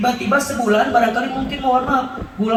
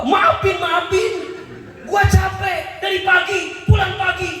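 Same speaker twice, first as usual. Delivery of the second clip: higher, shouting tired husband; angry husband; 7 semitones higher than usual. Retold or imitated. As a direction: snap back at the wife, fed up and shouting. Suami marah, capek. (suami capek berteriak marah kepada istri)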